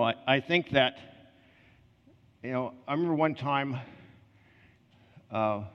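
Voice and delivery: ordinary talk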